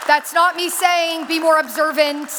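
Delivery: loud, slow, emphatic rally; rousing. Real, not acted. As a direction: drive each phrase home to the crowd. Rallying climax of speech.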